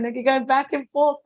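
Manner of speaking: ordinary delivery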